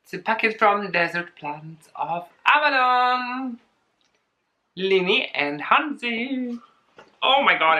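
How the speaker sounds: normal talking voice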